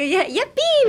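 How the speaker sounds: high, playful yippee with a laugh; gleeful cheer. Acted. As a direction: squeal it like a silly little cheer. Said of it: "みたいなか、感じの".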